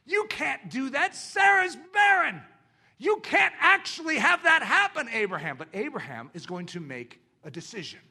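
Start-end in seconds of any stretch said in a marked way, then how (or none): 0.1-5.6 taunting inner voice of doubt, high and loud. Acted. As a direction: shout down faith like a scornful heckler. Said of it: "What's the voice saying?".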